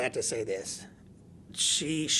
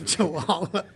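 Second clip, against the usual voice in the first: Chihuahua repeated higher and louder with a laugh and a rising end; 7 semitones higher, 7 dB louder than usual. Real, not acted. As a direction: laugh at the dog's silly name Chihuahua.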